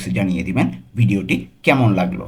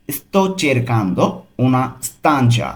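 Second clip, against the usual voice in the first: slow, careful Italian for learners; very slow model reading; much slower than usual. Shown as a demonstration. Teacher models the phrase slowly for students. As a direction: spell it out slowly for the class. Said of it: "তাহলে পুরোটা কী হবে?".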